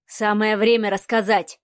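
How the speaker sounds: ordinary delivery, nothing marked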